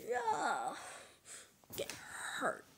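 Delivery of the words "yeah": ordinary delivery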